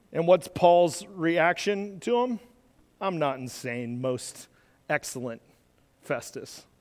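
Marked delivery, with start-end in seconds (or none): 3.0-6.7 much softer and lower, calm; calm reply. Acted. Paul calmly answering the shouting governor, soft and low. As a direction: answer quietly and steadily.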